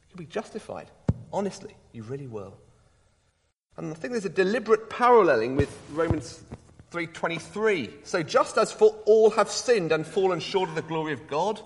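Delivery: ordinary delivery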